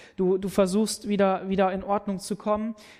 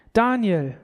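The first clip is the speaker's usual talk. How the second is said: a plaintive cry of the name, raised; plaintive call; 3 semitones higher, 7 dB louder than usual. A narrator's reading. The king calls to Daniel at the pit, plaintive. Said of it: "mit kläglicher Stimme".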